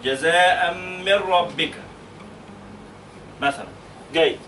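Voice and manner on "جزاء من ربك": "من ربك" said slowly, the nun merged into the ra; slow, careful Quran reading; slow recitation. Shown as a demonstration. Teacher models the verse slowly for the class. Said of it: "teacher models the recitation".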